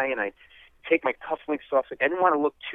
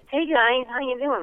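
nervous greeting; 11 semitones higher than usual. Retold or imitated. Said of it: "I'm up there".

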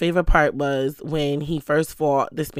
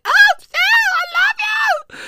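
much higher, shouted and slow; excited fan shout; slower than usual. Retold or imitated. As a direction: scream it like a crowd member losing it.

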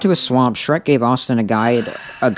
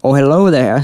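loud, high Scottish-ish ogre greeting; Shrek voice; 4 semitones higher, 6 dB louder than usual. Acted. Reading fanfic aloud in a loud Shrek voice. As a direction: boom hello like an ogre. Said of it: "said the mighty green ogre".

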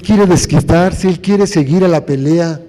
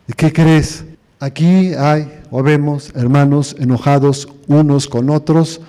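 ordinary delivery

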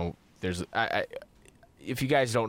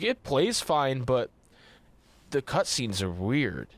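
slow imitation; 3 semitones higher, slower than usual. Imitating friend's complaint.

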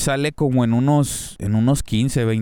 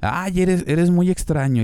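a hint of a girlish voice, pitched higher; light higher mock voice for the girl; lightly voiced girl; 7 semitones higher than usual. Retelling the girl's words to Denji, lightly mock girlish.

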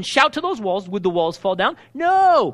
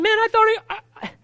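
frustrated believer; 7 semitones higher than usual. Retold or imitated. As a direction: whine it as if the devil came back. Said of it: "you're saying".

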